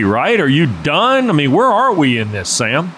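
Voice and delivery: normal talking voice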